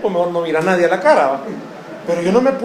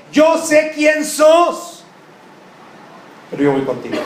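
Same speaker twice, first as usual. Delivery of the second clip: God voice; 5 semitones higher than usual. Acted. Preacher voices God's promise.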